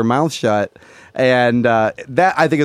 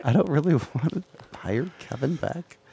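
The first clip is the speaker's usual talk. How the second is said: hushed aside; 4 semitones lower, 8 dB quieter than usual. Real, not acted. Whispered aside.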